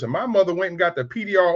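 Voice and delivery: usual speaking voice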